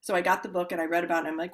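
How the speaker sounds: ordinary delivery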